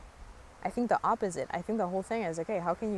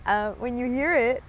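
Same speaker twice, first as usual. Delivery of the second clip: laughing burst, much higher; real laugh; 9 semitones higher than usual. Real, not acted. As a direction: crack up at the beep, real laughter. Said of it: "I don't know if you heard it right now".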